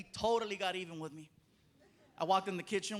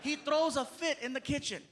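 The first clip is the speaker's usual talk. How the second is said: normal talking voice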